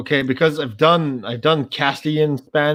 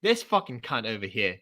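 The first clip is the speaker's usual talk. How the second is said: lower, softer Australian grumble; Australian accent; 3 semitones lower, 8 dB quieter than usual. Acted. Mock-insulting in Australian English, low Australian accent. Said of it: "that's fucking Australian English, mate".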